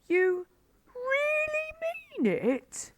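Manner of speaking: very high, rising, leaning on really. A narrator's reading. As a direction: Badger stunned at the offer. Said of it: "cried Badger".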